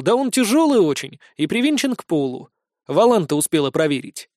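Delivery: ordinary delivery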